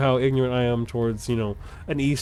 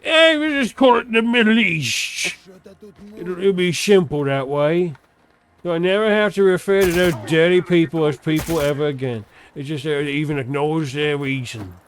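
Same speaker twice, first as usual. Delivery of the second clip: snooty Englishman; 4 semitones higher, 5 dB louder than usual. Retold or imitated. Mocking an old English official naming the Middle East, high and haughty. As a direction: lord it over them like a smug old colonial. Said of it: "some English fucker from, like, yesteryear".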